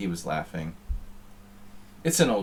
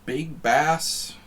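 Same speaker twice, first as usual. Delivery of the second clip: put-on much higher, louder arcade game announcer with a Canadian accent; 9 semitones higher, 6 dB louder than usual. Streamer imitating the arcade fishing game's announcer, loud Canadian announcer voice. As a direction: belt out the game title like the arcade fishing game does. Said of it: "that has the Canadian accent".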